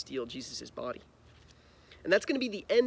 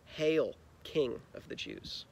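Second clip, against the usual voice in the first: slow, understated sneer; a hint of soldiers jeering; mild mockery; 3 semitones lower, 4 dB quieter, slower than usual. A narrator's reading. Soldiers mocking Jesus, lightly sneering and slow. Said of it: "mocked him".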